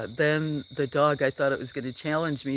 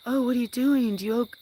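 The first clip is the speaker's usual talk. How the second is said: put-on high child voice asking questions; curious kids; 10 semitones higher, faster than usual. Kids quizzing the stranger, in a high child voice. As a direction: sound like a nosy little kid.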